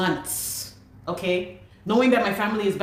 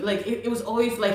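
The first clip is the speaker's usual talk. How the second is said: ordinary talk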